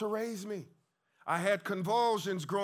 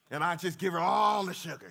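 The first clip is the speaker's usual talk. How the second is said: excited burst; 5 dB louder, faster than usual. Real, not acted. Playful recalling kisses.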